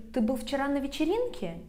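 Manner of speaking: quick question, pitch jumps late on "вечеринке"; stress on ВЕЧЕРИНКЕ. Shown as a demonstration. Teacher asking was it the party, stressing "вечеринке". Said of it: "I could ask you like this".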